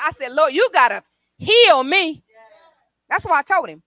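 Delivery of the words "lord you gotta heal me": loud, insistent appeal; forceful plea. Retold or imitated. Retelling a prayer to be healed, forceful plea. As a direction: demand it loudly from God.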